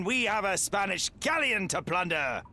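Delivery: high, slow pirate captain bellow; gruff dock worker hollering. Acted. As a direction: roar orders across the deck. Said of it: "I know you want to do it".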